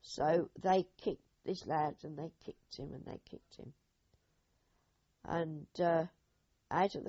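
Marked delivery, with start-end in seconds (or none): 2.0-3.7 repeats softer until it drops to a whisper; fading to a whisper. Real, not acted. Remembering the boy being kicked, fading to a breathless whisper. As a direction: let the horror drain your voice away. Horror at the kicking.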